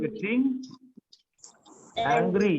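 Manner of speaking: very slow, spaced-out sentence; teacher modelling a sentence very slowly; slow dictation. Shown as a demonstration. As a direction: draw each word out for the class. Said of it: "यहीं से आपको ध्यान देना है".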